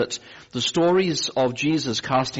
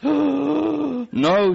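higher, strained no after a gasp; 5 dB louder than usual. Retold or imitated. Gasping patient refusing the nurse, breathless. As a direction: push the no out on a gasp. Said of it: "gasping for breath".

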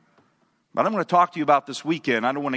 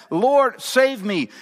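high, raised plea for rescue; crying out; 9 semitones higher, 5 dB louder, much slower than usual. A narrator's reading. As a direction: call out for help from the waves. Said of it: "But while the billows talk".

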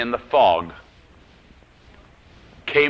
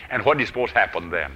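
usual speaking voice

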